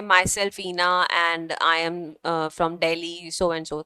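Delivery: slow it right down, as a wrong example. Shown as a demonstration. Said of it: "तो हमें वो नहीं करना।".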